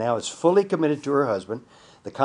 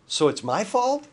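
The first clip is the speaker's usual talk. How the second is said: a much higher, offended "my fault"; indignant surprise; 9 semitones higher than usual. Retold or imitated. Husband recoiling at the counselor, indignant. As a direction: protest in disbelief.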